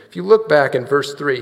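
ordinary talk, nothing marked

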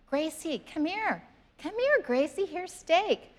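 high, sweet coaxing dog call; calling a puppy. Retold or imitated. Coaxing her puppy with steak.

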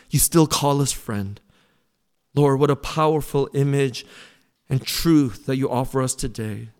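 low-pitched, steady congregational prayer; leading prayer. Real, not acted. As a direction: lead the room in earnest prayer, pitch dropped. Leads the closing prayer.

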